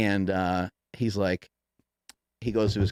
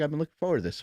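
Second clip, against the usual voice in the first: higher, nervous repeat; mock nervous; 6 semitones higher, much faster than usual. Tom Segura repeats his line, a little nervous. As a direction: say it with put-on nerves. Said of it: "He was a little nervous".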